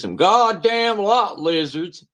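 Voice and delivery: imitating YouTube guy. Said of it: "this guy says".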